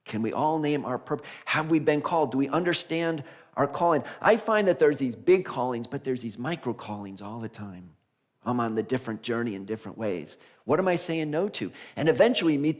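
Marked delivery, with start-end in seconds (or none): none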